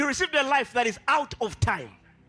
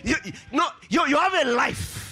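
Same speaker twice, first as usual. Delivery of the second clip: emphatic, raised preacher's voice; impassioned preaching; 3 semitones higher than usual. Acted. Preacher telling believers no man can scare them, impassioned. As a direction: drive each declaration home to the congregation.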